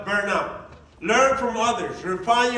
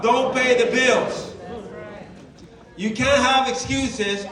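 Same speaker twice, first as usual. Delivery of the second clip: slow and louder, every word hit; emphatic rule; 2 semitones higher, 4 dB louder, slower than usual. Shown as a demonstration. Coach drilling the team on excuses, slow and loud. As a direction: hammer the rule home. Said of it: "coach stresses rule".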